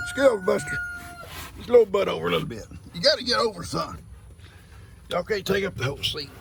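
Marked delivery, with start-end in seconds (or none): 0.1-2.7 very high voice to Buster; high coaxing. Real, not acted. Papa asking his goat Buster to scoot over. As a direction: coax the goat to move over.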